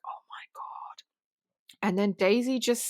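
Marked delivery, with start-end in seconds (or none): 0.0-1.0 aghast whisper, far softer; stunned horror. Retold or imitated. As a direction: whisper it as if the blood has drained away. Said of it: "all the blood drains out of your face".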